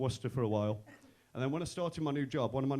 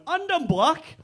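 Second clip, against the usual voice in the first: high, loud gleeful comeback; mock excited yell; 13 semitones higher, 8 dB louder, much slower than usual. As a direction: yell it high, topping the joke. Friend's laughing shouted comeback.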